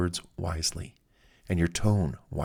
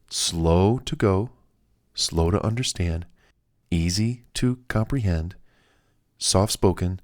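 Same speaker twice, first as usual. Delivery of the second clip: slow and soft-spoken; slower than usual. Shown as a demonstration. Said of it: "choose my words wisely".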